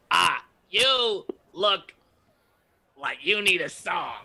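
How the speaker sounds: slow, stretched-out offer with a pause; showy bard. Acted. Bard stalling a half-orc, offering music theatrically. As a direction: draw out the pitch like a performer.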